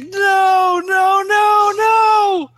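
high, loud drawn-out noes; despairing wail. Acted. Player as man crumpling to the floor, despairing wail.

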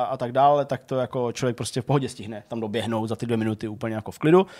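ordinary talk

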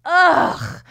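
high, loud "ugh" of disgust; loud disgusted groan. Retold or imitated. Mocking her own reaction to the worker with a loud groan. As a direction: groan like you can't stand this. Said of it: "And I was like".

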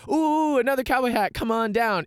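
high, delighted cowboy greeting; friendly excited invitation. Acted. Spotting a fellow cowboy hat from below, high and delighted. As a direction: call out in a high, welcoming lilt. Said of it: "it wasn't like a, like".

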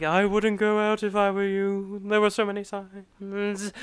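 lightly voiced ominous soothsayer. Soothsayer warning someone not to go out, lightly imitated. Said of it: "he's like the soothsayer".

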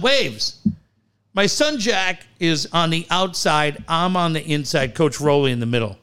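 ordinary delivery

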